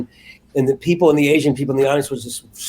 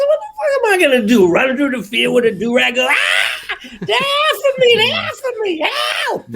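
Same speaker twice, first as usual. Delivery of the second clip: high, fast, panicky shouting voice; mayor impression; 14 semitones higher than usual. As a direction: play the mayor fleeing in mock panic. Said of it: "I'm gonna do her 'cause that's, I'm doing an impression".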